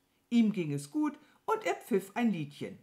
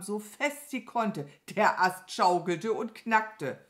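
plain reading voice

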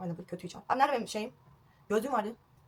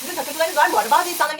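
higher, raised voice over the machine; nearly shouting over the noise; talking loudly; 4 semitones higher, 6 dB louder than usual. Real, not acted. Asking viewers about their obsessions over noise, loudly. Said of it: "bağırarak konuşuyorum".

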